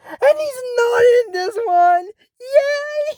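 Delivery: high-pitched gleeful celebration; squealing happy news; excited cheer. Real, not acted. Celebrates a missing character.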